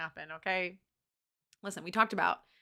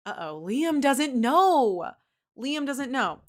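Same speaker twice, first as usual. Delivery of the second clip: 1 teasing uh-oh; 5 semitones higher, 9 dB louder, much slower than usual. Real, not acted. Aside on plot twist.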